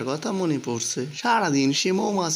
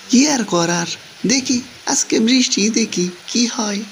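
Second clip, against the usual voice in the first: louder, raised over pouring rain; 7 dB louder than usual. Acted. Man stuck at a hillside window, calling over the rain. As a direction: call out above the downpour.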